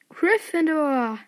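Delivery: stretched house-name call; Sorting Hat proclamation. A narrator's reading. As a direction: cry it out slowly, stretching the last syllable. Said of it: "rief der Hut".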